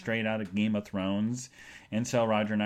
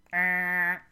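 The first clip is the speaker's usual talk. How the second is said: high, held buzzer noise; sustained "aah" like a game-show buzzer; wrong-answer buzz; 8 semitones higher, 4 dB louder than usual. Retold or imitated. Reacting to salesman who doesn't know, held buzzer sound.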